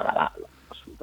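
breathless, barely voiced words; out of breath. Real, not acted. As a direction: gasp it out short of breath. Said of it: "ho il fiatone".

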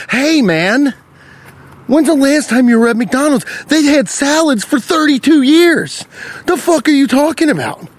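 high-pitched, incredulous rant; exasperated retort. Acted. Podcaster to dithering customer at McDonald's, exasperated rant. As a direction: climb high and scold in disbelief.

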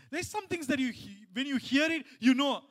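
high, loud pulpit delivery; loud, high-pitched preaching; preaching at full force. Real, not acted. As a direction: drive the point home to the congregation.

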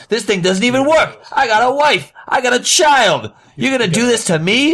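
high, wailing complaint of a cheated customer; hammed-up indignant outcry; outraged yelling. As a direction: plead your case at a high-pitched yell. Acted quarrel with the seller.

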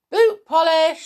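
high-pitched loud call; shouting. A narrator's reading. Tom shouting down the mouse hole, shouting. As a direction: shout it to fetch someone. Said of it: "shouted Tom".

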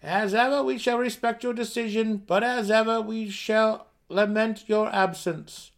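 high, loud formal farewell; parting gnome voice. Acted. Gnomes at the door farewelling Burrow Warden, high loud formal voice. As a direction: proclaim it respectfully from afar.

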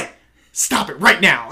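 loud, firm command to stop; mock stern shout. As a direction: break up the fight with a sharp order. Kevin Hart calming a fight.

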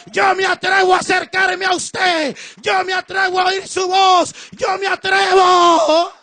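loud, driving preaching cry; bold declaration. Acted. Moses volunteering to climb the mountain, loud and fervent. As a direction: declare your courage at full force. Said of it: "dijo Moisés".

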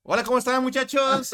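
high, loud teacher greeting; overly cheerful greeting. Retold or imitated. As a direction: greet the class with a big, forced cheer. Nervous teacher greets the class.

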